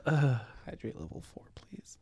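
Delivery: whispered quote. Retold or imitated.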